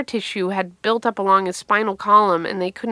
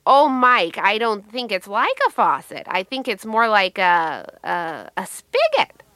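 mother chiming in; slower than usual. Acted. Mother corrects son about the faucet, lightly acted motherly voice. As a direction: play a hint of a well-meaning mother, searching for the word.

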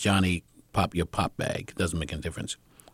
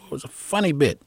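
usual speaking voice

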